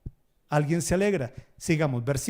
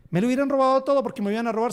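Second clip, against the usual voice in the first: high, loud and rushed; tumbling out in a happy rush; excited; 7 semitones higher, 5 dB louder, much faster than usual. Retold or imitated. Congregant telling the pastor he'd have been robbed, fast and excited.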